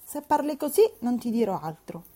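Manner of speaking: put-on sulky spoiled girl threatening silence; capricious young lady's pout; capricious retort. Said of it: "ribatté capricciosamente".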